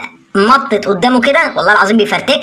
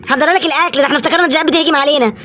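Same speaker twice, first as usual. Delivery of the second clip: ordinary talk, nothing marked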